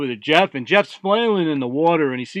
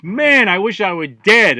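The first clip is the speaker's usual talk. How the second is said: loud, slow, higher-pitched griping; pissed off; 4 semitones higher, 6 dB louder than usual. Acted. Sunburnt Jeff complaining to god, loudly pissed off. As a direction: drag out the complaint in exasperation. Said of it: "Jeff's really pissed off and goes".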